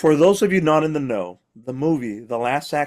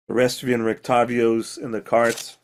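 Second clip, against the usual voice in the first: ordinary delivery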